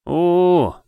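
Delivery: high, loud mock-scared ooh; hammed-up fearful wail; theatrical mock shiver hoot. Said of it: "картинно поёжился он".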